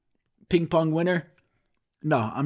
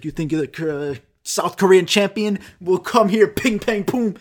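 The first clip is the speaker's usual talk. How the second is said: raised pitch, building to loud; mock taunting challenge; 4 semitones higher, 4 dB louder than usual. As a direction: imitate a cocky champion calling out a challenger. Teacher taunting opponent in retelling.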